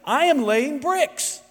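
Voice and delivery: slow, high, mock-obvious answer; sarcastic bricklayer. First bricklayer answering the stranger, slow and sarcastic. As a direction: spell it out like it's obvious. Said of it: "he replied sarcastically".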